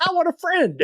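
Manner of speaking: put-on childlike plea. To the coworker who rejected him, high childlike plea. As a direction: ask for a friend like a small child. Pleading for friendship.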